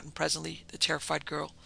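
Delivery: usual speaking voice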